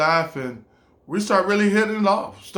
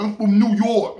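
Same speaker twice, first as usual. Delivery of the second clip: imitated tough New Yorker, pitched up; raised, proud New York comeback; New York attitude; 5 semitones higher, 4 dB louder than usual. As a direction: declare it with hometown swagger. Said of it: "the New York came out".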